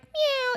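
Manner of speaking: high little meow; a tiny sweet mew. Retold or imitated. Imitating Girl Kevin's sweet high meow. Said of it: "Girl Kevin's like".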